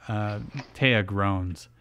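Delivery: ordinary delivery, nothing marked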